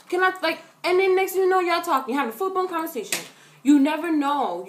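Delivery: ordinary delivery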